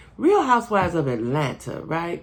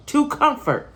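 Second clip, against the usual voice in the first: "to comfort" jumps much higher, insisting; emphatic higher repeat; 10 semitones higher, slower than usual. Real, not acted. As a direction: say "to comfort" again, up high, pressing the point.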